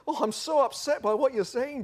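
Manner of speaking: high-pitched hammed-up hurt; theatrically wounded, pitched high; mock upset. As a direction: wail it in mock offence. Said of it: "he says, oh".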